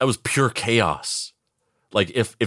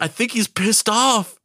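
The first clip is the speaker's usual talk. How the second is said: much higher, slow pretend panic; mock panicked realization; 13 semitones higher, slower than usual. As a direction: squeak it out as a hostage catching on. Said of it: "I would be like".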